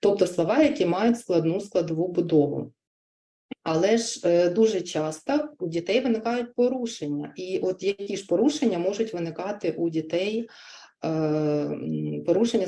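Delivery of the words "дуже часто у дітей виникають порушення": ordinary delivery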